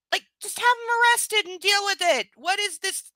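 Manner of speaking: high, loud exasperation; exasperated rant. Real, not acted. Reader fed up that nobody calls the police, exasperated. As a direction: voice shoots up in fed-up disbelief. Ranting at the book's plot.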